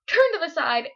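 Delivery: ordinary delivery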